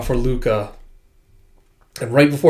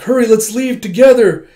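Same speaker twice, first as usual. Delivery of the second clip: higher, louder urging; urgent plea; 9 semitones higher, 7 dB louder than usual. Acted. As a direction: plead with him to run now. Fleeing the monster.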